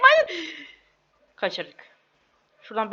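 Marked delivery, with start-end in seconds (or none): 0.0-0.5 sudden high shout of dismay. Real, not acted. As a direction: cry out no in shock. Falls in game, cries out. (ani haykırış)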